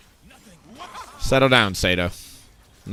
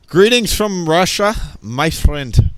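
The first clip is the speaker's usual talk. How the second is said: mock Russian accent, a bit higher and louder; 6 semitones higher, 3 dB louder, slower than usual. Streamer greeting chat in a mock Russian accent. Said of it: "This is my best Russian accent".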